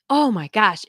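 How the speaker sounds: ordinary talk, nothing marked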